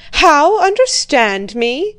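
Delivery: loud, slow, rising angry cry; a father crying out at his daughter in anger. A narrator's reading. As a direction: hurl the question back at her in anger. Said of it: "cried her father angrily".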